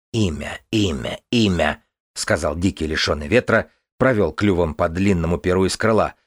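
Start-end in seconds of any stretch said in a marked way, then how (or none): 0.1-1.8 голос существа-птицы. A narrator's reading. Существо повторяет слово.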